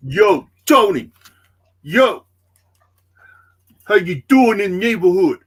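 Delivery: loud, high, brash New York tough guy; New York accent. Pretend. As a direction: call out to Tony in a big New York accent. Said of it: "my New York accent's getting better".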